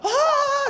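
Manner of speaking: very high, happy sigh of relief; hammed-up high coo of delight; smiling delighted "ah". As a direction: melt into a high, blissful "ahh".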